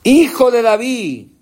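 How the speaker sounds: a blind beggar's pleading cry. Retold or imitated. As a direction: call out to the passing king. Preacher cries out Bartimaeus's call.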